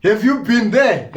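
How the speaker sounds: challenging critics who mock his accent; incredulous challenge. Real, not acted. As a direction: throw the question back in disbelief.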